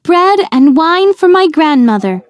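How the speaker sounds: put-on high, bright little girl voice; little girl, guileless and cheerful; young girl answering. A narrator's reading. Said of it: "the girl answered".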